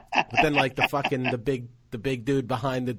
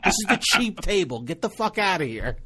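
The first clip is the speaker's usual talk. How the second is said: retold angry rant; 7 semitones higher than usual. Telling off the know-it-all at the cheap table, high mock rant.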